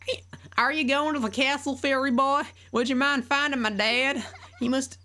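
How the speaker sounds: put-on very high girl voice with a country accent; country farm girl voice, high and sweet. Voicing Malon.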